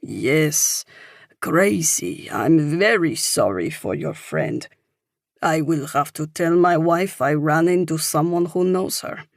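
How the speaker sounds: put-on older Frenchman's accented English; French-accented, with halting phrasing; thick French accent. A narrator's reading. Older man offers sympathy to a stranger, thick French accent. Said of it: "he says with a thick French accent".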